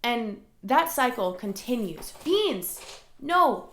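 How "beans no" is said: sharp high call to stop the cat; real scolding call, louder and higher. Real, not acted.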